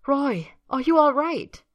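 urgent, high and loud; alarmed. A narrator's reading. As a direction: cry out in alarm to a friend. Melody panics over collapsed Roy.

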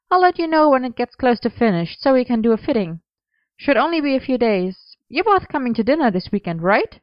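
put-on a breezy, businesslike young woman; Gwen, brisk and friendly. A narrator's reading. Gwen voiced by narrator.

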